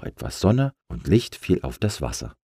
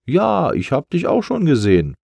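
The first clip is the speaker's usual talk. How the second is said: high, louder, inviting jellyfish voice; friendly jellyfish; 11 semitones higher, 6 dB louder than usual. Acted. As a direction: coax the balloon down to play. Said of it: "sagte die Qualle". (hohe, einladende Quallenstimme)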